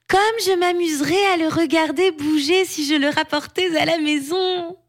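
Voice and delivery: put-on high-pitched eager little girl; childlike glee, pitched high; delighted giant girl. A narrator's reading. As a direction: daydream aloud in a high child's voice.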